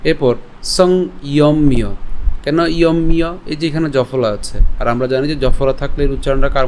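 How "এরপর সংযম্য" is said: সংযম্য drawn out slowly as sɔŋ-iom-mio; slow syllable demo. Shown as a demonstration. Teacher slowly breaks down সংযম্য for students. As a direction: split সংযম্য into slow parts. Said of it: "এরপর দ্রিয়ানি".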